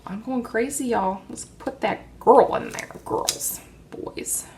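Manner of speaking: normal talking voice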